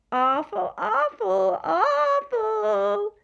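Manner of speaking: loud, high mocking horde yell. A narrator's reading. Furry horde jeering at the visitors, yelling. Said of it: "yelled the furry horde".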